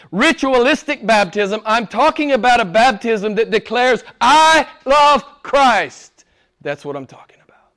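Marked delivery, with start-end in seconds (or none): 4.2-6.2 slow, high, loud proclamation; emphatic, drawn-out cry of devotion; bold declaration. Acted. Voices what baptism declares. 6.6-7.7 quick, whispered follow-up; very soft, rapid aside; hushed emphasis. Real, not acted. Hushed aside after loud declaration.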